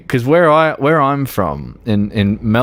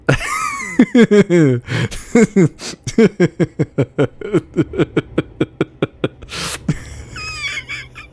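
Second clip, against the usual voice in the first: long shrieking laugh; 9 semitones higher than usual. Real, not acted. Drunk player howling with laughter at his driving.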